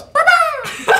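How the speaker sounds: very high, loud silly call; squeaky silly greeting. Retold or imitated. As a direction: shriek it back from across the house. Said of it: "greet each other in ridiculous voices".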